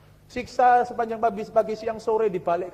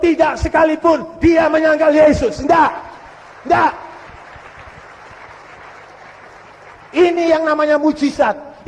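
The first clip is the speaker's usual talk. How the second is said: loud, raised, emphatic pulpit proclamation; full-force preaching; 5 semitones higher, 9 dB louder than usual. Acted. Preacher proclaiming God's miracle to the congregation, forceful shouted preaching. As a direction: hammer each phrase home to the congregation.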